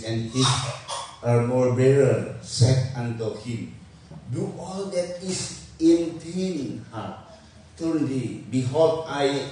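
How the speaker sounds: drawn-out, deliberate English reading; slow scripture reading. A narrator's reading. Preacher reads the English verse to the congregation, slow and deliberate. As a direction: read the verse very slowly, phrase by phrase.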